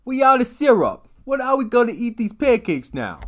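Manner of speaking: very high, loud outraged goon; shouting wiseguy. Acted. Henchman telling the boss about syrup, yelling. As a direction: wail it like a panicked mob henchman.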